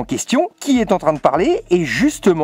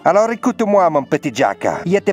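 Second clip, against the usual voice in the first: put-on a playful Italian godfather accent, lightly done. Calling Jack on the phone to scold him, in a mock Italian accent. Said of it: "j'ai pris mon meilleur accent italien".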